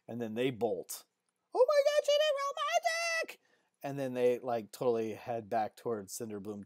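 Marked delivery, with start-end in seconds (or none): 1.5-3.4 very high falsetto shriek; panicked girl squeal. Acted. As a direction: squeal it like a startled girl running off. Girls bolt, squealing.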